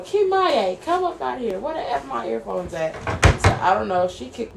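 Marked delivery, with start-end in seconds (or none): 0.0-2.9 high mock yell, coming down in pitch; imitated angry sister. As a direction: call out like a sister banging on the door. Imitates angry sister banging on door.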